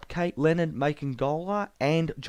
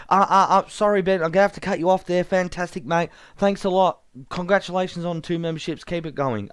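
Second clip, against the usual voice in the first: louder, fast hurried wrap-up; flustered cut-off; 5 semitones higher, 5 dB louder, faster than usual. Acted. Host [name] hurriedly cutting off Ben's call, loud and fast. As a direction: rush to shut the segment down. Host hastily cuts Ben off.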